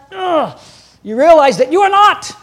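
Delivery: groan, then exclaim it loudly with a laugh; exasperated outburst, played for laughs; mock exasperation. Preacher humbled, laughing at himself.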